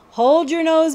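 ordinary delivery, nothing marked

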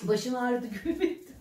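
usual speaking voice

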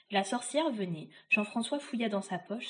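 plain reading voice

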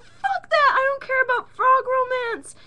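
very high, loud, mock-hysterical yelling; shrieking at Sandy over frog romance; furious shriek. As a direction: scream it out in outrage. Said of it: "And I'm like".

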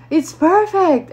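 high, loud, stretched-out mock approval; enthusiastic customer's reply. Rapper to the speaker about the juice, exaggerated approval. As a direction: imitate him, high and drawn out.

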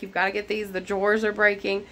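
normal talking voice

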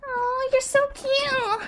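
put-on high, slow baby-talk coo; drawn-out sugary coo. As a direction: gush over an adorable baby. [name] coos over the baby.

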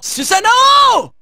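slow, high drawn-out call; summoning shout. Acted. Hero calling Susanoo to fight, drawn-out high shout. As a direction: stretch the name into a shout.

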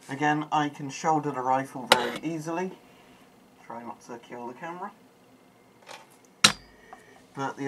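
muffled behind mask voicemitter; speaking inside sealed respirator; through a gas mask. Real, not acted. Seen 1: Full-face gas mask worn over mouth throughout; references show bare face.

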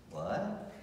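puzzled question with a steep rise. Retold or imitated. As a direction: imitate a baffled reply, voice swooping up. Bully's puzzled look voiced.

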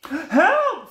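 high, loud yelp for help; hammed-up scream for help; mock panic. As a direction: cry out as a frightened cartoon fish. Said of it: "We're afraid of the shark".

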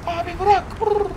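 high pleading sidekick voice; voiced pet begging. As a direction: plead to be let go. Voicing Samet pleading.

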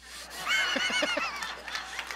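a big high-pitched laugh bursting out. Real, not acted. Preacher laughing at his first-class upgrade story, big high laugh.

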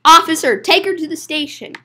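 fluent order with a loud opening; brisk order. Acted. Ordering the officer to take her away, briskly. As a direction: call the officer, then order it in one run.

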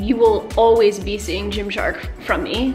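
ordinary talk

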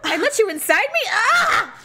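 high, appalled laughing cry; mock horror. Friend imagining seeing an old lover, mock horrified. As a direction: shriek in comic disgust at yourself.

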